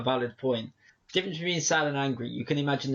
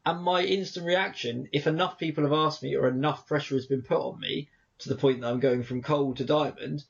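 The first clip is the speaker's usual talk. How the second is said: usual speaking voice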